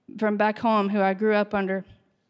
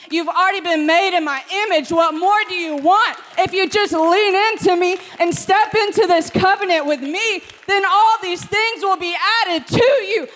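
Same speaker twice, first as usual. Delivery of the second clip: higher and louder, building to a booming answer; voice of God; 5 semitones higher, 5 dB louder than usual. Acted. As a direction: answer as God, firm and climactic. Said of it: "But he said".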